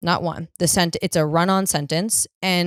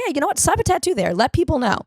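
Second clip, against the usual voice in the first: much higher, mock-bright pep; mock enthusiasm; 9 semitones higher, faster than usual. Woman mocking her own tattoo idea, mock enthusiastic. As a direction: pitch it like a brilliant idea.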